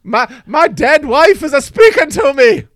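put-on loud, slow Scottish accent, high-pitched; a Scottish husband hearing his dead wife, in an over-the-top Scottish accent. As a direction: ham up a Scottish widower. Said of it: "That's my Scottish accent".

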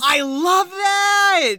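high, loud and drawn out; delighted. Real, not acted. Cohost delighted by the backwards line trivia, gushing slowly. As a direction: gush with real delight over the trivia.

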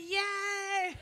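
high, loud squeal of joy; imitating a thrilled daughter's yay; excited cheer. Daughter cheering over new jeans, high excited squeal. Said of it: "She's all excited".

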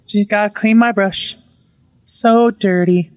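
eerie possessed-wife voice, drawn out; slow, nonchalant. Retold or imitated. Possessed wife looking at the camera, slow and nonchalant. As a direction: draw it out slowly as if nothing's wrong. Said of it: "like, slowly ... like, nothing's wrong, nonchalant".

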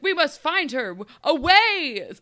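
high, loud grandiose proclamation; mock Shakespearean hero. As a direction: ham it up like a stage knight. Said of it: "in Shakespeare vibe".

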